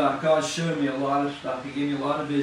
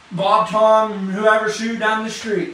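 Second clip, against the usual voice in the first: emphatic preaching; 5 semitones higher, 8 dB louder, much slower than usual. Real, not acted. Preacher listing names emphatically.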